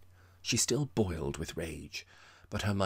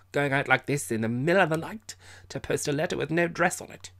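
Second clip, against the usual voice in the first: loud, high indignant ranting; drunken bluster; 9 semitones higher, 8 dB louder than usual. A narrator's reading. Drunk Captain accusing Miss Mapp, loud and indignant. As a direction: bellow back the accusation.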